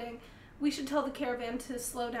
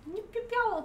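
high yipping jackal call; jackal warning yip; 9 semitones higher than usual. Acted. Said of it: "I'm going to give a warning".

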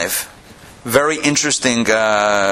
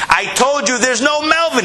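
screaming in anger; 13 semitones higher than usual. Retold or imitated. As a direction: blow up at the caller who keeps phoning. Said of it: "the fellow on the other end is screaming".

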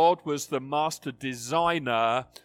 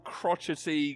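ordinary talk, nothing marked

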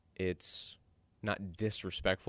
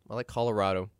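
ordinary talk

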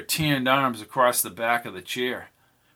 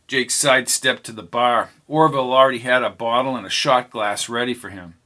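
ordinary delivery

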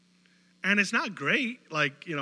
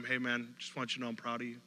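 much softer, hushed reassurance; tender encouragement; 4 semitones lower, 10 dB quieter, faster than usual. Retold or imitated. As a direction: tell them gently you are proud. Parent tenderly saying proud of you.

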